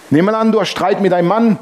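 ordinary talk

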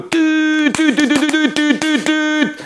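high mock car horn beeps; horn honks. Angry driver honking the horn at the car ahead. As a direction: beep the horn with your voice. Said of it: "Ich auf die Hupe".

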